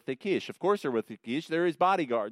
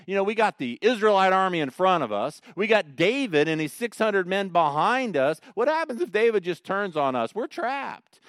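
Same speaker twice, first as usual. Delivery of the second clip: panicky Philistine princes; 7 semitones higher, 7 dB louder than usual. Retold or imitated. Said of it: "So what are they saying?".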